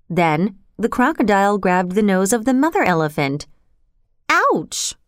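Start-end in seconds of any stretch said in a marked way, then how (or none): 4.3-5.0 scream. A narrator's reading. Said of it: "she screamed".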